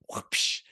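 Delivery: breathy whoosh sound effect; mouth-made whoosh. Retold or imitated. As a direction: blow out a quick whoosh as you zoom by. Rolling past after high five.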